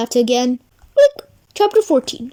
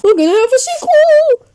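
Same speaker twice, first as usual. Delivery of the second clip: much higher, bright announcement; thrilled; 9 semitones higher, 3 dB louder than usual. Acted. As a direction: shout it with glee. Excited announcement.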